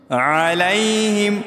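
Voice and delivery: علیہم stretched very slowly; teacher demo; slow careful. Shown as a demonstration. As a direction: draw out علیہم syllable by syllable. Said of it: "عین زبر ع".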